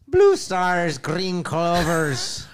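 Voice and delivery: loud, high leprechaun Irish; hammed-up Irish cereal mascot, drawn out; mock Irish accent. Mascot cereal slogan in fake Irish.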